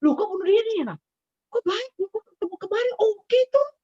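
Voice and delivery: high astonished questions about the news; shocked disbelief. Retold or imitated. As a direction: gasp it out in disbelief. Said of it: "Kaget kan?".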